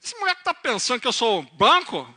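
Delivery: put-on loud, indignant Piracicaba country accent. Farmer reacting to his son's telegram, angry Piracicaba accent. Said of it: "Ele era mais piracicabano".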